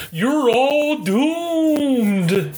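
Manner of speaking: very slow doom-laden warning; slow ominous raspy drawl. Retold or imitated. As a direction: drag it out like a doomsayer. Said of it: "He's like Crazy Ralph from Friday the thirteenth".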